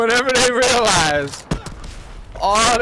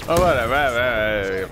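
normal talking voice

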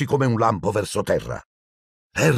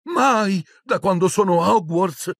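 much higher, stunned scolding; shocked outburst; 11 semitones higher than usual. Acted. McGonagall splutters in shock at Harry flying. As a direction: splutter in outrage. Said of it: "quasi senza parole per lo shock".

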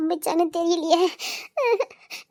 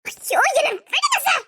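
high, pleading ayyo; pleading cry; 7 semitones higher than usual. Acted. Girl carried off cries ayyo, begging the man to let go.

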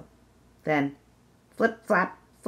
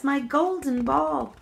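higher, slow happy exclamation; delighted princess; 10 semitones higher, 3 dB louder, slower than usual. Acted. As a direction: savour the words with joy. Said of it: "said the princess".

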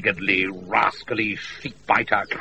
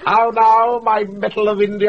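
gleeful greeting; 11 semitones higher, 5 dB louder than usual. Acted.

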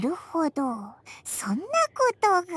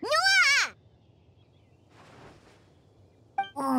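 startled yelp; 4 semitones higher than usual. Acted. Startled by sudden appearance.

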